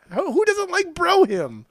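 high, indignant disbelief; incredulous. Real, not acted. Teasing friend over a song choice, high incredulous squeal.

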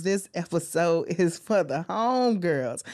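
ordinary talk, nothing marked

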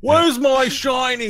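very high, loud, drawn-out ogre bellow; ogre yelling down. Acted. Ogre demanding his shiny from the sheriff, yelling. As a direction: bellow slowly like a huge simple ogre. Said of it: "he yells down to the, to the sheriff".

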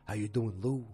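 lightly imitated man's voice; hint of an impression; Henry Francis. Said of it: "This is how he talks".

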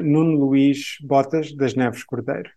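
Portuguese name said the Portuguese way; native Portuguese name. Shown as a demonstration. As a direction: give it the true Portuguese sounds. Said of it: "it goes like this".

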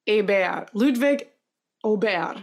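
Aubert said AY-bear, Ludwig with a V sound, LOOD-vig; new name pronunciation. Retold or imitated. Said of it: "they were calling him".

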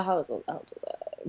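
hushed, fading nearly to a whisper; muttering to self. Real, not acted. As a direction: murmur it while searching your memory. Thinking back to the year moved.

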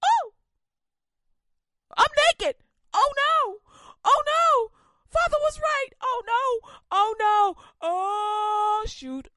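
hammed-up terror at being naked; shrieking oh no over and over; panicked shouting. Adam discovers he is naked.

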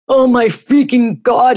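high, loud howl of pain. Acted. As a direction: howl it out in agony. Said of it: "he howled".

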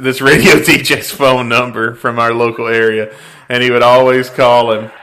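ordinary talk

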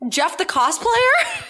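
high squeal of laughter; laughing disbelief. Real, not acted.

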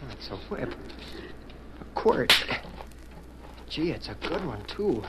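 put-on boy hushed over a whip found by a dead man. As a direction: murmur over the find to yourself. Alone, examining a found whip.

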